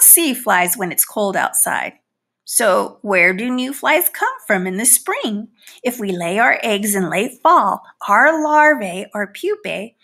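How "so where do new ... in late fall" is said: plain reading voice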